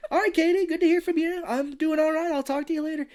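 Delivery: high, hammed-up phone cheer; fake-cheery sign-off. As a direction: brightly talk over the caller. Acted fake-cheery sign-off over caller.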